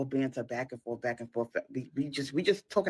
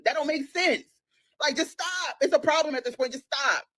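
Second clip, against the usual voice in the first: high, exasperated telling-off; exasperated scolding; 10 semitones higher than usual. Retold or imitated. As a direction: plead for sense, pitched right up. Retold scolding about his binge logic.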